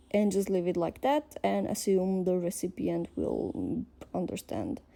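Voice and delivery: normal talking voice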